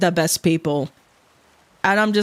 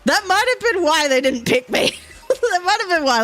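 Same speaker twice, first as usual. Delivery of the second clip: higher, laughing through the words; amused realisation; 10 semitones higher than usual. Real, not acted. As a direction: crack up at your own blunder. Laughing at own joke answer.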